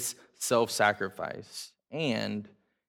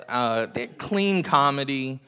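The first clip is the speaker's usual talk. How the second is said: ordinary talk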